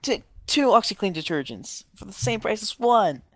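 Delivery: loud infomercial pitchman voice; Billy Mays. Retold or imitated. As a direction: sell it like a TV ad. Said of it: "Shout out to Billy Mays".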